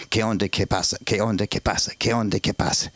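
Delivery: low Mexican cashier patter; repetitive, low-pitched Mexican greeting. Retold or imitated. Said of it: "I'll do a Mexican voice".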